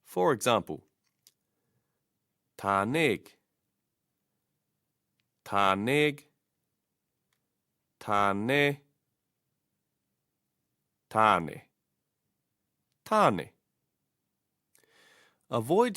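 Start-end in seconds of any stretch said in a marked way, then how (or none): 2.6-6.2 "tāneg" ending like egg; slow drill of "tāneg", eh closed by g; practice form with g. Shown as a demonstration. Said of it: "by practicing with tāneg". 8.0-13.5 "tāne" ending in eh, as in egg; g left off. Shown as a demonstration. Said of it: "then leaving off the two G's".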